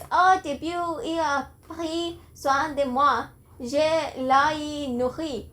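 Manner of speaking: a non-speaker voicing a possessed girl's French, slow and careful; attempted French. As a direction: read the French lines haltingly, as a learner. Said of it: "see my attempt".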